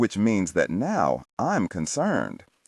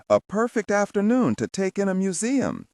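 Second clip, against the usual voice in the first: Pendergast voice; 12 semitones higher than usual. Acted. Pendergast's voice, second take.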